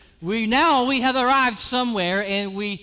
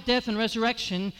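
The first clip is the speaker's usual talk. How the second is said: ordinary talk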